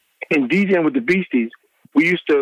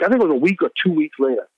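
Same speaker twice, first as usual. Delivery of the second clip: normal talking voice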